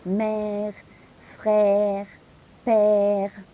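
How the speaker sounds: mère, frère, père with an open è, mouth wide. Shown as a demonstration. Teacher drilling mère frère père with open mouth. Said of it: "بفتح فيها بقي".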